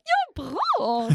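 high-pitched cheer of approval; retold excited praise; delighted praise. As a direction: cheer the student on, voice leaping up. Acts praising student who got it.

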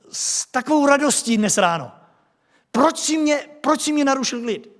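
high, loud, aggrieved whining; loud, high-pitched grumbling protest; whiny complaining man. Acted. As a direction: play the man irritated that Jesus disturbed his peace. Acts the man annoyed at Jesus.

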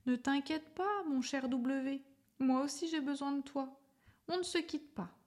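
high, gentle reassuring voice; lightly voiced caring letter; tender reply.

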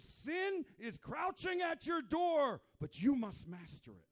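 high, loud, slow shout; God warning Cain in a slow shout; booming voice of God. Retold or imitated.

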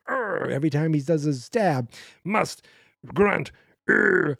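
high, loud hammed-up grunt; mock grunting. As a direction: grunt it out like a tennis serve. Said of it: "[name]'s like".

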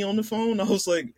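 ordinary delivery

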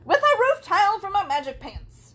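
high, loud hammed-up Yzma villainess; Yzma impression. Podcaster camps up the cartoon villain Yzma, loud and high. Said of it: "I just turned into Yzma".

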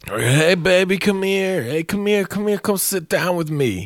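put-on high, sleazy drunk come-on; drunk creep. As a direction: coax her over like a slurring barfly. Drunk man hitting on ex.

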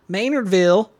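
Maynardville with a high, loud southern twang; southern accent. Shown as a demonstration. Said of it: "You can't say Maynardville".

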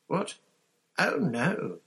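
a high alarmed squeak. Acted. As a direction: squeak it out in dismay. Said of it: "Hermione squeaked".

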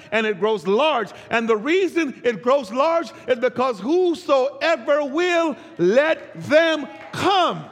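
measured, drawn-out phrases rising to a high, ringing finish; preaching build to climax. Real, not acted. Preacher offering the congregation a place of refuge, building, impassioned preaching. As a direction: build the refrain and stretch the last words out strongly.

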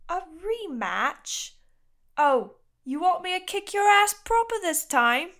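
high, incredulous put-on boy's voice; Chase. Suspicious boy answers the rematch taunt, high challenging voice. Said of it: "Chase is like".

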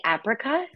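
"apricot" said AP-ri-cot, as a question; short-A pronunciation. Shown as a demonstration. Host quizzes co-host on the word, short-A apricot. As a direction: say "apricot" with the A of apple. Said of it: "What do you say".

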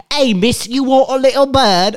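high, loud street-seller hawking; bird seller voice. Retold or imitated. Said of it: "He's like".

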